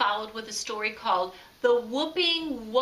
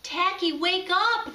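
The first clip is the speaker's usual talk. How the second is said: high, urgent call; pleading to wake him; frantic penguins again; 8 semitones higher, 3 dB louder than usual. A narrator's reading. The penguins calling Tacky again, high and urgent. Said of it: "they repeated".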